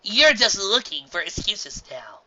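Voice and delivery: slow, pointed accusation; accusing. A narrator's reading. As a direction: call her out. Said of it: "she accused".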